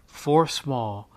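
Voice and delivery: plain reading voice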